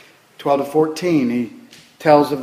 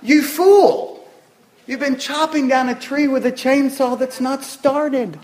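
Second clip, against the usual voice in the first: mock scolding shout; 7 semitones higher, slower than usual. Mock scolding the foolish lumberjack.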